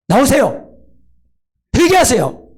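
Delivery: high, loud demanding call; confronting call. Shown as a demonstration. A counselor confronting a sinner, loudly demanding. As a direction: call them out sharply to repent. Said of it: "이렇게 직면하는 거".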